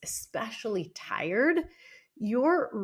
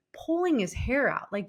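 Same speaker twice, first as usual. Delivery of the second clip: ordinary delivery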